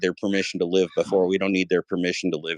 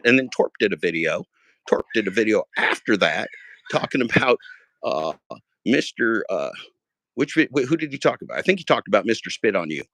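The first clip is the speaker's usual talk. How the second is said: ordinary delivery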